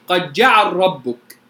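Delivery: قد جعل ربك slow, lam merged into a doubled ra; Susi reading. Shown as a demonstration. Teacher recites قد جعل ربك the Susi way for students, lam merged into ra. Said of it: "لو هقرأها للسوسي".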